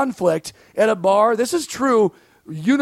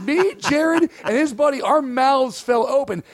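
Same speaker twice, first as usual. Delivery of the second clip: ordinary delivery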